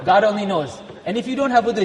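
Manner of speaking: usual speaking voice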